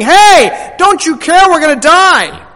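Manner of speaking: frightened disciples shouting to wake him; acted terrified yell in a boat; panicked scream. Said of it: "We're screaming".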